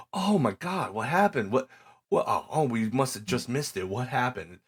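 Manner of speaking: high, hammed-up fake shock; mock surprise. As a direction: overplay innocent surprise at the scene.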